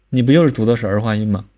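ordinary talk

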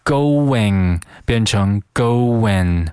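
"going" turning to "goin"; final g dropped. Shown as a demonstration. Teacher shows learners the change, "going" losing its final g. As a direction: say "going" slowly, then with the final ng softened to n. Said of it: "把那个后鼻音G去掉".

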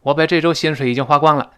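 usual speaking voice

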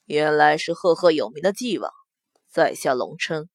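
low, measured male voice; courteous young lord. A narrator's reading. Long Chen introduces himself to the prince, low and courteous. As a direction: greet a rival lord formally, pitched low.

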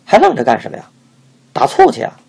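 high, loud scolding; harsh rebuke. A narrator's reading. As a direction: scold him out the door. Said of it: "师娘竟申斥道".

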